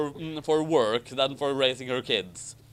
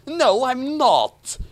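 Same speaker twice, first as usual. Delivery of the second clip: mock brave retort; 11 semitones higher, 7 dB louder, slower than usual. A narrator's reading. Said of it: "Harry fired back bravely".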